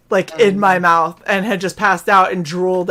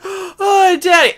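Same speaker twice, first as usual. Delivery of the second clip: high, teary "oh, Daddy"; weepy relief; 8 semitones higher, slower than usual. Retold or imitated. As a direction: cry out in relief like a drugged child. Drugged daughter calls for dad.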